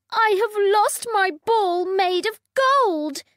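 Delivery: high, loud upset princess; wailing spoiled princess; cartoon princess. A narrator's reading. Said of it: "said the princess".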